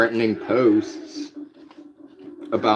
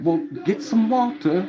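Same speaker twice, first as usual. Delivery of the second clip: high-pitched mock cop; police officers; 8 semitones higher than usual. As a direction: put on the officers' higher voice. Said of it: "they were like".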